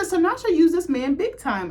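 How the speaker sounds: loud high outburst. Real, not acted. Fan outraged at how Billy was used, loud and high. Reacting to the show.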